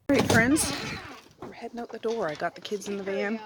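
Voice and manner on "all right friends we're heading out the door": loud greeting over the van noise; loud cheerful. Real, not acted. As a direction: call it out brightly. Heading out with kids.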